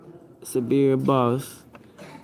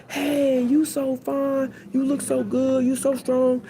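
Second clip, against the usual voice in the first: high, slow swooning fan voices; fawning admirers; 13 semitones higher, slower than usual. Acted. Admirers swooning over the tall basketball player, high and slow. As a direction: gush over him like starstruck admirers.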